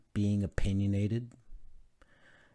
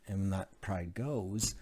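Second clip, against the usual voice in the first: ordinary talk, nothing marked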